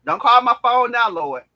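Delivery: loud, emphatic plea to the Lord; mock protest to God. Speaker tells the Lord not to phone yet, loud mock protest. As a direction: raise the voice, fending off a call from above.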